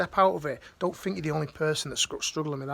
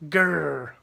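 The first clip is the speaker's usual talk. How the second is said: angry growl; 7 dB louder than usual. Retold or imitated. Acting out an angry Facebook status.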